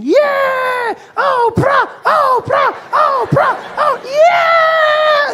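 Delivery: put-on very high, loud children's screams; screaming children. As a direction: shriek her name like excited kids running out. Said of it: "they run out screaming".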